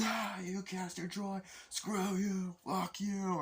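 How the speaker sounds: high, slow taunting voices; mock the new inmate, jeering; jeering prisoners. Prisoners taunting Castor as he walks in, jeering. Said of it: "people going".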